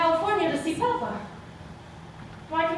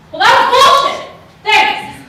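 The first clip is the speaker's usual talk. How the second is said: much higher, loud furious yell; angry outburst; 9 semitones higher, 14 dB louder than usual. Acted. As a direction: explode at her for lying.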